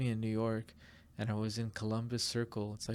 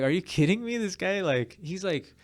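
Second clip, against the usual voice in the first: higher, louder incredulous rant; disbelief; 8 semitones higher, 8 dB louder than usual. Retold or imitated. Vendor protesting to the cops, loud and incredulous. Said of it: "I'm like".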